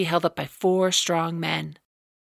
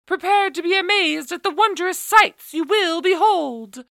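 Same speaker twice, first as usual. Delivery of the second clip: town crier; 12 semitones higher than usual. A narrator's reading. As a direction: announce it to the whole market. Proclamation read to market crowd.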